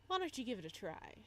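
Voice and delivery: usual speaking voice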